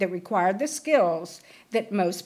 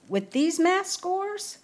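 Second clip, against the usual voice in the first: higher, slow incredulous question; doubtful counselor; 9 semitones higher, slower than usual. Retold or imitated. School counselor doubting the girl's grades, high and slow. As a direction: raise the voice in mock disbelief.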